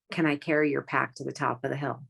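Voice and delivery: ordinary talk, nothing marked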